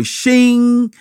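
high, sharp "Shing" like a blade drawn; sword shing. Retold or imitated. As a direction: imitate a sword sliding out of its sheath. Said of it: "That's the sound of a sword".

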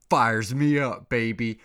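loud, hyped-up yell; a loud hype-man burst; fired up. Real, not acted. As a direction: get pumped and shout it. Hyped about golf shot.

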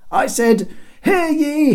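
high, loud crier's repeat; hollering herald. A narrator's reading. Herald repeating himself to the guard, hollered. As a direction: holler it up to the wall. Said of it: "Cedric was about to holler again".

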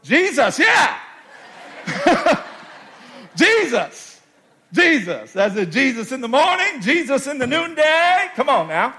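sermon hype calling out Jesus; preacher's exclamation. Acted. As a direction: lift the name up to the crowd. Preacher's call-and-response exclamation.

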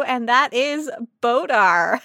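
ordinary talk, nothing marked

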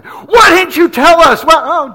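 high, loud, drawn-out protest; mock outraged wail. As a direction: wail it like let-down fans. Team protests at missing the star.

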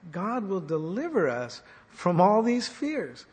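ordinary talk, nothing marked

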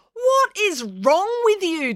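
high, drawn-out outrage; slow, high-pitched reproach; indignant demand. Acted. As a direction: demand it in exasperation. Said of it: "demanded April".

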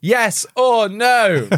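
high, slow, drawn-out question; mock demand. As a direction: press her, slowly and pointedly. Repeating Nick's demand.

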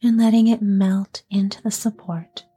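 unhurried yoga nidra voice, letting each phrase settle; guided sleep meditation. Acted. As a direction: ease a listener toward sleep, phrase by phrase. Leads guided sleep relaxation.

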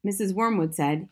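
ordinary delivery, nothing marked

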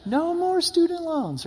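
a playful, hammed-up whoop; mock celebration. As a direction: cheer like dancing at the party. Mock party dance cheer.

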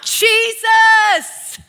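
high, drawn-out desperate shout; loud plea, each phrase stretched; crying out. Acted. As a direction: call slowly across a crowd for mercy. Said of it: "he began to cry out".

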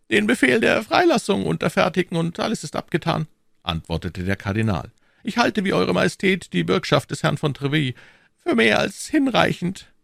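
silky churchman stepping back, high voice; cardinal's voice. A narrator's reading. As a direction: play the sly cardinal, pitched well up.

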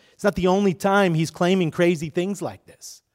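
normal talking voice

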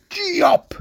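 high, explosive axe-blow shout; chopping cry. Acted. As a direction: punch it out like a swung axe. Acting out the axe blows.

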